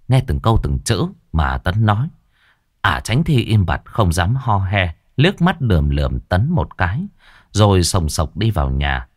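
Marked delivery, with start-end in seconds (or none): none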